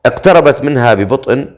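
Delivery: ordinary delivery, nothing marked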